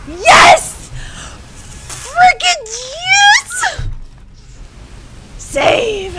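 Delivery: high, loud yell of victory, laughing; triumphant shout. Real, not acted. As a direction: scream it out, you finally made it. Triumphant after beating a section.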